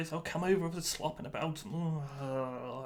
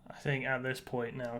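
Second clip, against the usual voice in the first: normal talking voice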